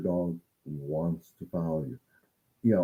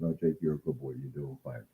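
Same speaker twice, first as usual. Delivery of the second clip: softer, gentle praise for the grand dog; soft aside to the dog; 8 semitones lower, 9 dB quieter than usual. Real, not acted. Trainer turns aside to praise her grand dog, soft and gentle. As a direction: murmur kindly to a nearby dog. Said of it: "Sorry, talking to my grand dog here".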